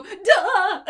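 high, loud startled yelp; Pacha. Retold or imitated. Friend imitating Pacha spotting an old man, loud startled yelp. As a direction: blurt it out as a startled exclamation. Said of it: "watching Pacha go".

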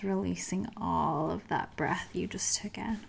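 soft whispered release; whispering. Acted. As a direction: breathe the words out in a whisper. Meditation exhale cue.

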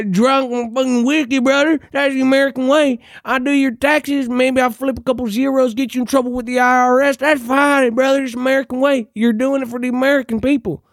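mock drunk bro accountant. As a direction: play the whiskey-soaked accountant saying brother proudly. Mocking a boozy bro accountant.

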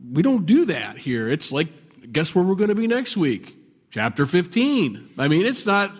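ordinary talk, nothing marked

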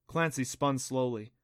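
ordinary delivery

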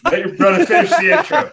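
talking through suppressed laughter; trying not to crack up; stifled giggles. Real, not acted. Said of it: "[name]'s trying not to laugh right now".

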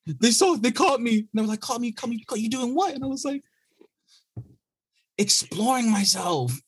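put-on very high child's voice owning up; sheepish kid confession. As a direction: confess like a guilty little boy.

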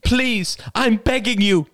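high, loud desperate begging; mother begging her son forcefully; sudden force. A narrator's reading. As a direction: burst out begging. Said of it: "with sudden force".

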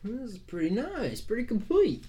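put-on high, creaky old man voice; old prospector. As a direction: peer at it like an old codger. Old mapmaker examines map.

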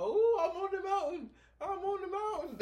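very high, loud mock-gleeful voice; mocking false cheer. Mocking those who always claim they're on the mountain, in high fake cheer. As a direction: squeal it like someone pretending all is great.

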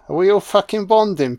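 ordinary talk

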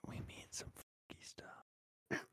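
slow suggestive whisper. Acted. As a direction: breathe it secretively, slowly. Suggestive aside.

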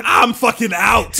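slow, loud bail-out yelled through laughter; loud high panicked quitting; laughing shout. Retold or imitated. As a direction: get out of there, loud and laughing. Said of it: "The dude's like".

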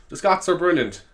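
ordinary talk

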